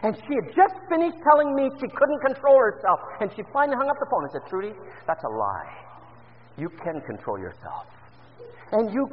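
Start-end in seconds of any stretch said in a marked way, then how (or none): 4.5-7.9 quiet firm rebuke. Retold or imitated. Pastor confronts Trudy.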